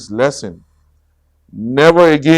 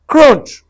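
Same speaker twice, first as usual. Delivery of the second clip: loud crunch noise; 13 semitones higher, 8 dB louder than usual. A narrator's reading. Scary noise.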